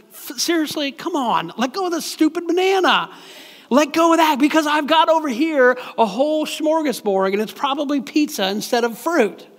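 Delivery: God losing patience, hammed up; exasperated God. God voiced, exasperated and insistent.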